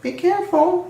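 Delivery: high fretful carriage voice; coaches' voice. A narrator's reading. Coaches warning Edward, high and worried. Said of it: "said the coaches".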